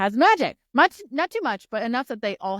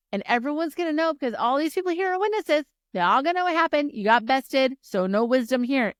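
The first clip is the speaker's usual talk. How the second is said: gloating challenge; 6 semitones higher, 3 dB louder than usual. Acted. Acting a triumphant challenge to rival.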